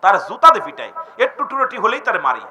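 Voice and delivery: ordinary talk, nothing marked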